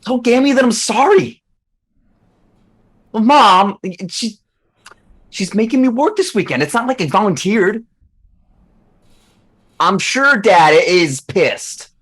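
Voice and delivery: put-on high, loud, whiny teen on the phone; annoyed teenager. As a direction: complain to mom like an exasperated teenager. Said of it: "he sounds like an annoyed teenager".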